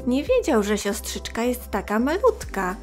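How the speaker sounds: usual speaking voice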